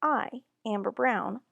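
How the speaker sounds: plain reading voice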